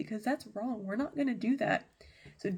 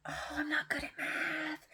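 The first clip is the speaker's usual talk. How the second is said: higher, softer reluctant groan; mock whine; 6 semitones higher, 4 dB quieter than usual. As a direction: whine like a pupil facing a quiz. Underling groans at surprise math quiz.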